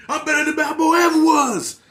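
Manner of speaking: mock the villain's whiny southern rant, shouting high; whiny southern accent. Reviewer mocking Tommy's bitchy whiny southern rant, high and loud. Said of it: "that southern accent".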